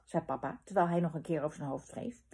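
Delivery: usual speaking voice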